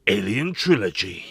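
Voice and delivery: low dramatic announcer title drop. Pretend. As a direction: announce "Alien Trilogy" deep and ominous.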